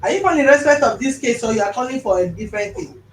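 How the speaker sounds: to someone hard of hearing. Real, not acted. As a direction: project to a faint caller. Loud questions to faint caller.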